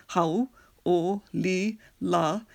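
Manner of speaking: slow, four separated syllables. Shown as a demonstration. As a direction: spell it out slowly by ear: hau, o, li, la. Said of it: "four syllables".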